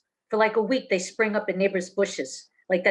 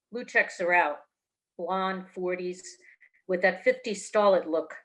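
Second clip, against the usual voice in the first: usual speaking voice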